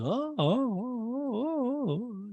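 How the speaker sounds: drawn-out listening oohs, swooping down; Mauricio. Retold or imitated. Podcaster mimicking Mauricio pretending to listen, crooned ohs. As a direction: croon mock acknowledgments. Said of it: "he's always like".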